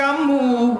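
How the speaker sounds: loud, high, lengthened call. Retold or imitated. Child calling to his weeping mother, loud high cry. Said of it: "মাকে ডাক দিয়ে কে".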